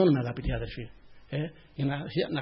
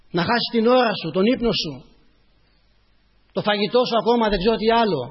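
raised, emphatic sermon voice; impassioned preaching; 5 semitones higher, 5 dB louder than usual. Real, not acted. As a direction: preach it out with rising fervour.

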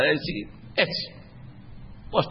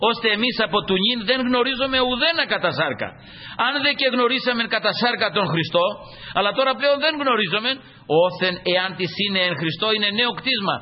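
raised, solemn reading of the verse; scripture reading; 4 semitones higher than usual. Acted. Preacher reading the verse to the brothers, raised and solemn. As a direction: lift the voice to read scripture.

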